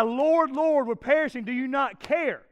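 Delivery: panicked plea, voice lifted; crying out in fear. Retold or imitated. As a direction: cry out like sailors in a storm. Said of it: "they wake up and they cry".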